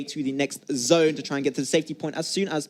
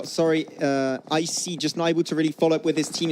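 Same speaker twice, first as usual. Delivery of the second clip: ordinary talk, nothing marked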